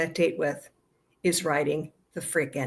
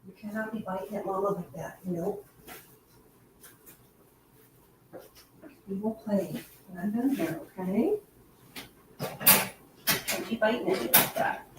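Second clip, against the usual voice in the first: much softer, half-whispered telling-off; hushed scolding; 8 dB quieter than usual. Real, not acted. Owner quietly scolding her dog for biting, hushed. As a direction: scold the puppy under your breath.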